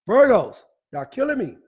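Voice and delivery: exasperated exclaim. Real, not acted. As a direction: throw up your hands at the Virgos. Host exclaims at Virgo count.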